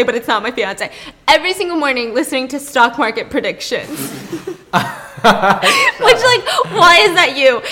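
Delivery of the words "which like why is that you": high, loud laughing question. Real, not acted. Laughing at fiance comparison.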